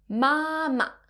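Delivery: "Mama," held high and level; "Mama," like a sustained high note; first flat tone. Shown as a demonstration. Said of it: "which has the first flat tone".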